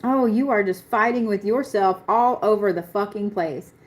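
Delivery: usual speaking voice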